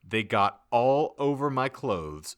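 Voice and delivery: ordinary talk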